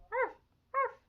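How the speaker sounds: high yapping little-dog bark. Acted. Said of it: "Webby barks".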